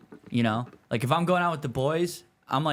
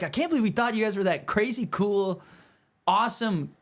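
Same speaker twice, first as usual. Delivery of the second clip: mock gushing; 7 semitones higher than usual. Mock admiration at the table.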